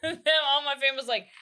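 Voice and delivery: ordinary talk, nothing marked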